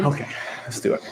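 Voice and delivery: quick soft laughing okay; laughing breathy agreement. Real, not acted. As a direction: laugh it out under your breath. Laughing agreement to more push-ups.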